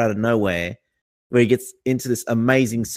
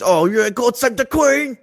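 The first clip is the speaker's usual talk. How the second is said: much higher, slow, climactic British cry; hammed-up Brit crying out, high and drawn out; over-the-top British accent, very high and slow; mock British accent; 12 semitones higher, slower than usual. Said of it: "before he ejaculates".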